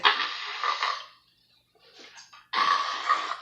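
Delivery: short breathy yell; mock ride scream. Retelling the last drop to viewers: short breathy scream. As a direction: let out a quick scream at the top. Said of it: "and I'm like".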